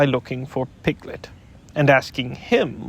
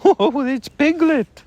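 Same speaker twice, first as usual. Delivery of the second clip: much higher, slow, eager call; eager cry; 12 semitones higher, 5 dB louder, slower than usual. Acted. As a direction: glad to have found his friend. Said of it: "cried Pooh eagerly".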